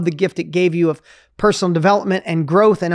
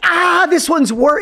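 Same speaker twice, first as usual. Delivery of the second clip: mock panic; 9 semitones higher, slower than usual. Guest voicing her panicking inner voice. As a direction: act out the brain's alarm.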